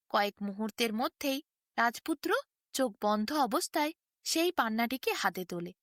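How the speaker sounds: ordinary delivery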